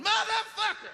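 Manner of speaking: a high, squealing burst of secret glee; inner joy scream. Retold or imitated. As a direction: jump up and down inside and squeal it. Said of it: "on the inside of my body, I was jumping up and down going".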